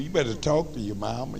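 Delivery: low, soft, fast hurried aside; fleeing daddy's aside. Retold or imitated. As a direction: hustle it out softly over your shoulder. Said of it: "he'll turn back and say to one of you".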